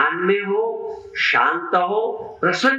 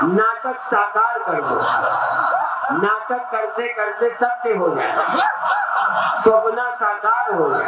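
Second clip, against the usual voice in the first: exuberant laughing exhortation; 5 semitones higher than usual. Real, not acted. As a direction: cheer them into real laughter. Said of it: "हंसो, सचमुच हंसो".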